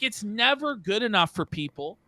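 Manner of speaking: ordinary talk, nothing marked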